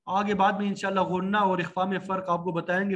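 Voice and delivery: usual speaking voice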